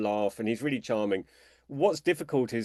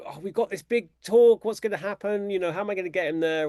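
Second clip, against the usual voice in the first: mock panic; 6 semitones higher than usual. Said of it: "It's like".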